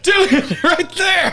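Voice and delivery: high, loud excited callout; excited shout. Real, not acted. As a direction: shout it out with glee.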